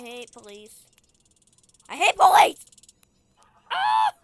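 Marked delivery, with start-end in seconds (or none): 1.9-4.1 high loud shout; higher louder playful rage; mock rage. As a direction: yell your hatred. Repeats line louder.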